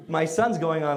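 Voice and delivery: ordinary talk, nothing marked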